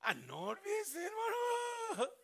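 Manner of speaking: loud, high, slow gushing sister; mock-sweet churchgoer gushing over a sister; overdone church greeting. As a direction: ham up the fake welcome, dragging the words. Fake churchy farewell.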